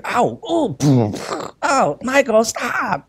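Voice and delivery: put-on high whiny child yelping at his brother; mock a kid's pained "ow, stop"; kid crying out. Father imitating his son complaining from the bedroom, high and whiny. Kids squabbling in the other room.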